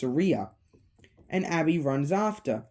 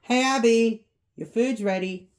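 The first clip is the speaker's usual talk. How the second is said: raised, higher call to another room; 6 semitones higher, 4 dB louder than usual. Acted. Mike summons Abby to eat, calling out. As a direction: holler that dinner is served. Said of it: "Mike calls out to Abby".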